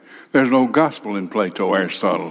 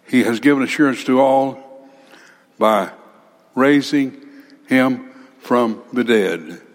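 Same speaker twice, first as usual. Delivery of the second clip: normal talking voice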